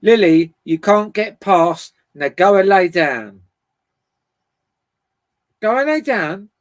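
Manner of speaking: loud firm order to the dog; stern command to dog. Real, not acted. Owner scolding her dog Lily, loud firm command.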